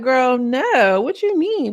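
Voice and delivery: imitating a miffed comeback, pitch leaping up; mock indignant retort. Renee answering Ivory who told her to stop yelling, mock indignant. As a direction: throw it back high and offended, as a retelling.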